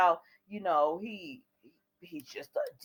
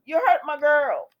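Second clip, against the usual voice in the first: angry shout; 6 semitones higher, 8 dB louder than usual. Pretend. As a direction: yell it higher and louder at Adam. Host repeats, louder and higher.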